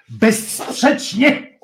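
slow, drawn-out, high exclamation; Wiesław Gołas. Retold or imitated. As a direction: stretch it out like an old comedy sketch. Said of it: "Jak powiedział Wiesław Gołas w sketchu W pociągu".

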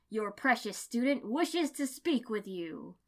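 a theatrical mentor, drawn out and proud; Guy Sensei voiced. A narrator's reading. Guy announces a student to his rival, booming. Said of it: "Guy Sensei said".

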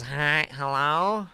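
slow, drawn-out nasal hello; dragged-out nasal hello; nasally hello voice. Retold or imitated. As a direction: mimic her nasal phone greeting. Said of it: "really nasally hello voice".

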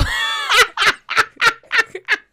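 high shrieking burst of laughter; loud laugh. Real, not acted. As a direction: crack up loudly, high and loud. Bursts out laughing mid-introduction.

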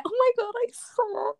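very high squeal; real fangirl excitement. Real, not acted. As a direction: squeal it in thrilled agreement. Fan excitement about singer.

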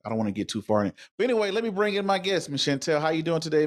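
ordinary delivery

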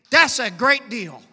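ordinary talk, nothing marked

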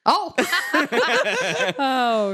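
bursting into laughter; laughing. Real, not acted. Laughing at the joke.

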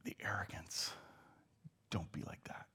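soft whispered aside. Real, not acted. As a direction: hush it to the congregation. Aside after mocking the crowd.

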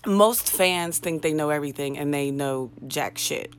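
ordinary delivery

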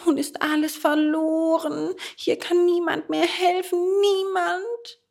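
high puppet voice, despairing; wailing lament. A narrator's reading. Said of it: "jammerte er".

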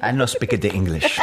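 joking foreigner voice with a laugh; mock broken English, laughing. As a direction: play the clueless foreigner, laughing.

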